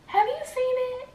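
very high, squeaky inner voice; held-back pleading question. Retold or imitated. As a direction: ask it in a tiny high voice, aching to know. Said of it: "I just wanna ask him so bad".

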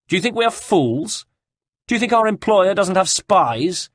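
high-pitched indignant questions; scornful challenge. A narrator's reading. Cecco challenges Caterina over the hidden apple, scornful. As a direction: sneer it upward, projected across a distance.

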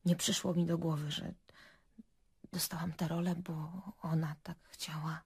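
very soft, almost whispered realisation; ciche uświadomienie. Acted. Gorzkie odkrycie podczas przesłuchania. (cicho, prawie szeptem)

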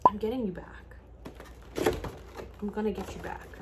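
barely voiced, near-whispered promise; under the breath; hushed. Real, not acted. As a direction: whisper it, overwhelmed. Overwhelmed by gift, barely speaking.

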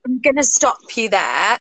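ordinary talk, nothing marked

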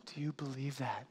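hushed, breathy ask to the singles; whispered question. Real, not acted. Pastor whispers her question to the singles.

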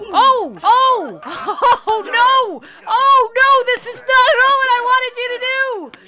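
high, loud alarmed yelps; shrieking in real panic; panicked shriek. Real, not acted. Streamer shrieking as the hippo attacks, panicked.

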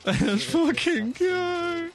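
slow, drawn-out hyped shout, high; hyped cheer. Real, not acted. Reactor cheering Sanji's entrance, drawn-out hyped shout. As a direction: stretch out the cheer, pumped.

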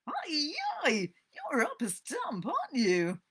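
put-on high, loud boyish taunt; drawn-out, high-pitched ribbing; teasing jeer. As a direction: call out mockingly to a friend. Ben jeers at Tom.

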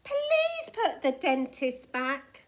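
slow, loud, emphatic request; weary telling-off, loud and slow; exasperated plea. A narrator's reading. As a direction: plead with a stretched-out please. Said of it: "sighed his mum".

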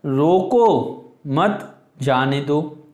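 रोको said firmly, then a pause before मत जाने दो; stress on रोको. Shown as a demonstration. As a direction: lean on रोको, break, then slowly मत जाने दो. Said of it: "रोको को हम जोर से बोलेंगे".

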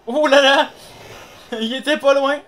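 loud high panicked cries; panicking gamer. Real, not acted. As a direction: panic as the monster returns. Monster returns, panicked.

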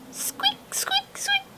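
tiny high whispered squeaks; very high whispered mouse squeaks. Acted. Mouse voiced in story.

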